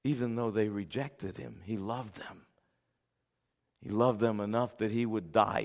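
low, soft, hushed sermon voice; tender preaching. Real, not acted. Preacher telling of Jesus's love for those Pharisees, tender. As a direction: let the sermon drop to quiet compassion.

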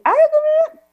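put-on high, whiny kid's complaint; mock whining child. The child protesting before obeying, high mock whine.

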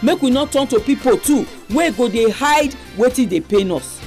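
usual speaking voice

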